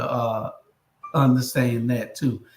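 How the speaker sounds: ordinary talk, nothing marked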